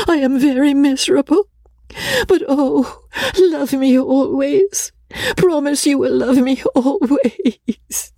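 very high, wailing plea; sobbing. A narrator's reading. Miserable Rebecca begging Miss Crawley for love, sobbing. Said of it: "sobbed Rebecca".

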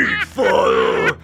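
deep pro wrestler roar; growl-shout it like a wrestling threat. Acted. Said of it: "hear that pro wrestler".

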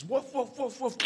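high, steady mock barks; dog woofing on. Dog waiting till something happens, imitation woofing. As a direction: woof repeatedly like a dog. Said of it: "the dog carries on woofing".

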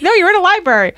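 hammed-up high-pitched scolding; scolding librarian. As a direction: play an exasperated librarian telling someone off. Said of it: "And they're like".

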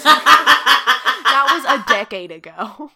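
laughing loudly through it; loud, slow and laughing. Real, not acted. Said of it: "I don't even know who that is".

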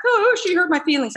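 high whiny mock sob; mock crying. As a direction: imitate someone crying over hurt feelings. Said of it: "in the back of the kitchen and crying like".

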